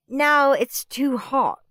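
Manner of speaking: put-on high, slow, sulky child whine; moody whine. Margaret complaining to Mum about her room, high sulky whine. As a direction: drag it out like a spoilt guest. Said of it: "said Moody Margaret".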